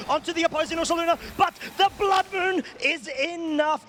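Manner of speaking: high, excited call of the hit; excited play-by-play. Real, not acted. Caster calling the Blood Moon hit, excited and high. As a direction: rush in high, then stretch out the result.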